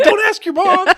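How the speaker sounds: very high, loud yelp through laughter; hammed-up alarmed shout, laughing; mock-panicked friends. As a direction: imitate kids frantically stopping their pal. Friends panic in the retold call.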